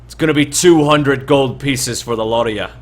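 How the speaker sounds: a hint of bullying toll-taker; bandit leader. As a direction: announce the price to the travellers like you own the road. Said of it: "and he says".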